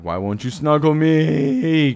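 high, slow whiny cat voice; voicing a cat before it is run over; mock cat voice. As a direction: plead like a needy cat. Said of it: "Yeah, like".

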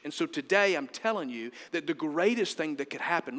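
ordinary talk

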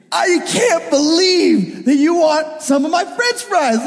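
drawn-out, high indignant tirade; going off. Retold or imitated. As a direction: stretch out the outraged disbelief. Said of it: "I just went off".

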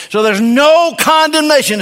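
"no condemnation" rung out high and loud, leaning on "no"; preaching proclamation. Acted. Preacher proclaims the key phrase.

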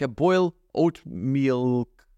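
slow, halting, tripping over oat milk; careful, tongue-tied delivery; stumbling over words. Real, not acted. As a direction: feel your way through the tongue-twister. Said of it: "This is so hard to say".

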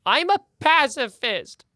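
high, loud snake pleading innocence. Acted. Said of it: "as it goes".

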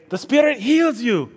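high-pitched mock healer pronouncement; Benny Hinn impression. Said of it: "Benny Hinn would hit them with his jacket and say".

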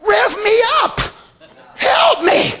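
begging churchgoer, lightly mocked; whiny mock plea. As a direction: whine it like a bored churchgoer. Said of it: "y'all sitting there".

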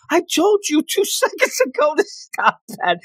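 high mock scolding with a laugh; exasperated scold. Takamine scolding the coach for gushing, high mock exasperation. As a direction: tell him off, high and laughing.

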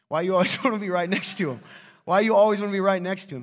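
playful teasing call-out; teasing shout. Real, not acted. Preacher teasing a congregant about his girlfriend, loud. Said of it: "He's so mad at me right now".

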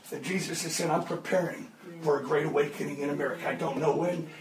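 low, soft intimate preaching; quiet, low earnest sermon close; hushed. Real, not acted. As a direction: bring it down to a near hush.

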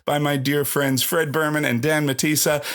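upbeat host welcome; podcast intro. Acted. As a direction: open the show brightly.

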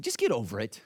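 low, offhand brush-off; blunt friend. Retold or imitated. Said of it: "a friend in our community group who's an eight".

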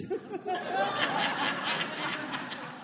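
high delighted burst of laughter. Real, not acted. Laughing at the memory.

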